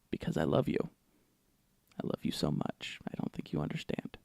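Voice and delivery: breathy mock-intimate whisper. Real, not acted. Whispered joke to viewers.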